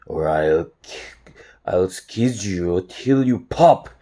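slow, drawn-out threat; low threatening voice. A narrator's reading. Harold threatens to squeeze Chester, slow and threatening. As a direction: menace squeezed out slowly, building to the last word. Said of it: "in a low, threatening voice".

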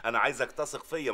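usual speaking voice

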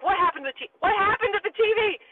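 high, alarmed question; rising mock panic; panicked confusion. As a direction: panic as the TV cuts out. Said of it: "we're like".

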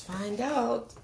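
plain reading voice

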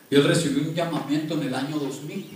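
usual speaking voice

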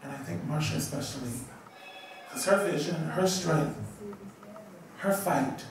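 soft, low, sincere thanks; heartfelt tribute. Real, not acted. Speaker thanking Marsha for a love that saved a life, heartfelt. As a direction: speak softly and from the heart, with pauses.

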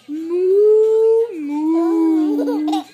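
loud drawn-out mooing; cow moo. Acted. Reading to a child, mooing like a cow. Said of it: "He could sound like a cow".

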